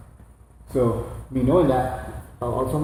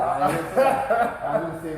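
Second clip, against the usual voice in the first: laughing; 4 semitones higher, slower than usual. Real, not acted. Denying joke while laughing.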